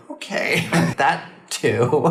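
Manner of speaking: laughing. Real, not acted. Laughing at the spa woman.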